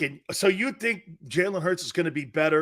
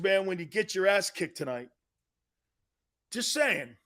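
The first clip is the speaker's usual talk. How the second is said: ordinary talk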